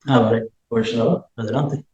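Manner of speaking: distant, muffled and faint; faint mic. Real, not acted. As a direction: voice far off the microphone. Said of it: "No se te oye bien".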